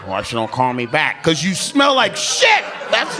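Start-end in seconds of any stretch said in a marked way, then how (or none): 0.1-1.2 low, soft puzzled guy voice; clueless guy. Retold or imitated. Clueless guy wondering why she doesn't call. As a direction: ask it dumbly. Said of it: "She's down there like".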